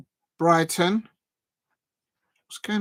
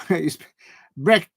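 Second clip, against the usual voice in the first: ordinary talk, nothing marked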